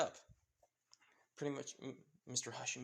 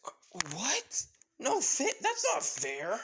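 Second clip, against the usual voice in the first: high, loud indignant whine; outraged protest; 11 semitones higher, 6 dB louder, slower than usual. Acted. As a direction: protest like a punished kid. Son protests his punishment.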